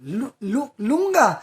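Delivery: stutter, then cry the name out high and loud; stammering shock. Retold or imitated. To the radio host on the phone, a stammered loud disbelieving cry. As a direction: trip over the name, then burst out. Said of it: "I said".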